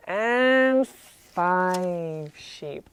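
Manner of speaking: very slow, stretched counting; drawn-out last count. Real, not acted. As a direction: hold AND and FIVE long before landing on sheep.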